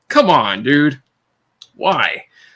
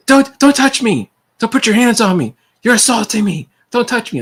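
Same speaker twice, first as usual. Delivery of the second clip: hammed-up angry customer yelling; angry shout; 10 semitones higher, 4 dB louder than usual. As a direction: yell it like an offended angry customer.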